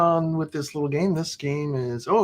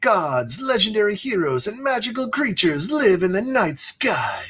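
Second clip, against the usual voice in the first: dramatic reading; 8 semitones higher than usual. Acted. Reads flavor text grandly.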